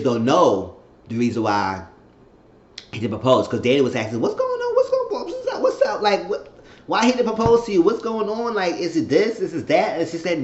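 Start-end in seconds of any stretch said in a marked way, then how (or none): 4.2-10.0 high nagging mock voice; pestering mimic. Danny grilling her about the proposal, in a high nagging mimic. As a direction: pepper her with questions in a squeaky mimic.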